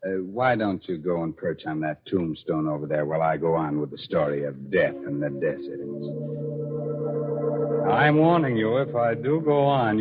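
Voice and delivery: ordinary delivery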